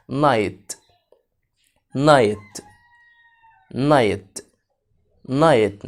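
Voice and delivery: نايت said as nait, no G or H sounded; silent gh. Shown as a demonstration. Teacher drilling kids on how to say night. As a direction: repeat نايت clearly with the gh silent. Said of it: "يعني أقول إيه؟".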